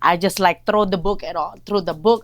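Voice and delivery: normal talking voice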